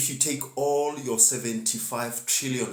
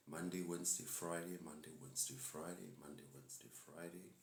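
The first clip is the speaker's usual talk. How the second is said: each repeat softer until nearly gone; fading list; 4 semitones lower, 19 dB quieter than usual. Shown as a demonstration. Host listing class days on stream, fading away. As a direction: let the days trail off into silence.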